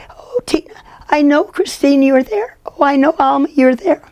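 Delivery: high, thin voice through the nose; reedy nasal voice. Retold or imitated. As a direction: imitate her reedy little nasal voice. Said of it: "funny, reedy little voice that went through her nose".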